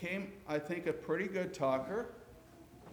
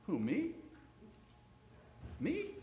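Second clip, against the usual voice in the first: surprised; 6 semitones higher, 7 dB quieter than usual. Acted. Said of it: "And Moses said, paraphrasing".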